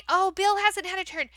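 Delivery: ordinary talk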